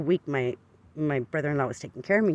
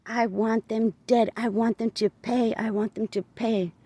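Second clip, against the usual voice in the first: slow, higher, crying out for revenge; tearful demand; 6 semitones higher, slower than usual. Retold or imitated. Answering the voice in the car, slow tearful demand for payback. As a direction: lean on every word as if begging. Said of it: "I was blubbering, crying".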